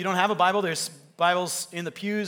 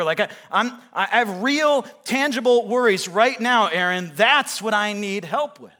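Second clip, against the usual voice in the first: raised, louder, emphatic objection; insistent protest; 4 semitones higher, 7 dB louder than usual. Acted. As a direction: press the complaint, pleading for practical help. Acts out an insistent congregant's objection.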